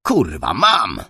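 excited pilot spotting the threat; keyed-up sweary radio shout. A narrator's reading. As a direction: burst out with it, jumping up in pitch. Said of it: "następny podniecony głos".

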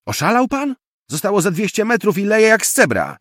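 very high, loud indignation; outraged protest. A narrator's reading. Passenger protests being dropped in rain, shouting outraged. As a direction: shout in disbelief, pitch way up.

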